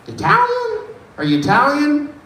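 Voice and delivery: very high, tentative question; acted high-voiced query; high-pitched guessing. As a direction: squeak out a wild guess, way up high. Acts out his guessing question.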